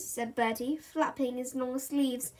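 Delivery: ordinary delivery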